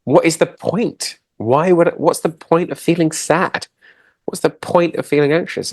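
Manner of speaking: high-pitched exasperated questioning; dismissive protest. Acted. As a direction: act out someone who finds feelings pointless. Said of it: "people see these emotions as, as, they, as useless".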